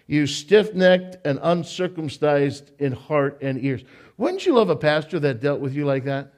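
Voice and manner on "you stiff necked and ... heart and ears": "stiff-necked" read slow and even; measured slow reading of "You stiff-necked". Shown as a demonstration. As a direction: read it slowly, savoring each word. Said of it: "I love the way he talks".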